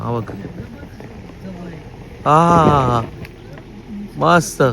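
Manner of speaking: ordinary delivery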